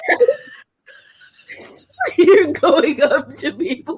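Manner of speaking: loud laughing through words. Real, not acted. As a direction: laugh while saying it. Laughing at friend's story.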